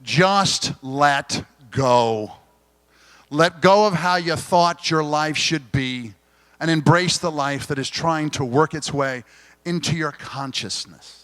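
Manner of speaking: slow, loud, deliberate recitation; reading a quote aloud. Shown as a demonstration. Speaker reading a favourite quote to the audience, slow and loud. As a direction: savour each word of the quote. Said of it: "I'm gonna read it too".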